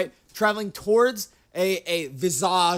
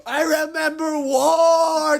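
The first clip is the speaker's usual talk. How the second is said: hammed-up war veteran; 8 semitones higher, 5 dB louder, slower than usual. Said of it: "He's like".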